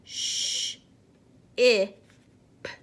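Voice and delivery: Sh, I, p sounded one by one; sounding out words. Shown as a demonstration. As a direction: segment each sound. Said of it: "Okay, ready?".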